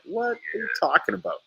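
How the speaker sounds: high-pitched retold disbelief; baffled retort. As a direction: act out bafflement, voice jumping up. Acted baffled reply to rival's question.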